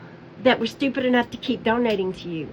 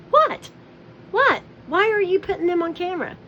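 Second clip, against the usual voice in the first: baffled disbelief, pitched way up; incredulous; 6 semitones higher than usual. Retold or imitated. Onlookers baffled at Candy filming passersby, high incredulous cry. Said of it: "and we're like".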